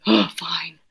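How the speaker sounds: whispered weary giving in; tired dad whispering his surrender. Acted. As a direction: breathe out a defeated whisper. Dad gives in at night.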